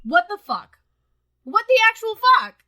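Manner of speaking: usual speaking voice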